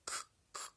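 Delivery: к, к breathed out without voice; just puff out the bare к sound, no voice; voiceless к demo. Shown as a demonstration. Teacher to pupils isolating the first sound, whispered k. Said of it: "Перший звук".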